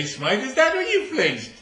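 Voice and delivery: high, loud query through laughter; judge, laughing. Acted. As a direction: crack up while asking. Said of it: "He says, I say".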